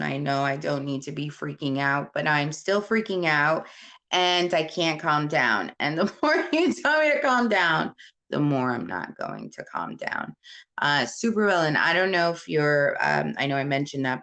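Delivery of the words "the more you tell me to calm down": exasperated. Real, not acted. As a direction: voice shoots up high in exasperation. Venting about being told calm down.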